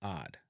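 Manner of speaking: HARD as "aad", no H and no R; dropped H. Shown as a demonstration. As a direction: say hard the Jamaican way without its H. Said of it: "eliminate the H sound".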